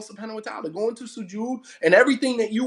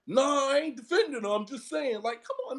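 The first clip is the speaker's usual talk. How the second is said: cowering husband; 4 semitones higher than usual. Retold or imitated. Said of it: "some men who cower in".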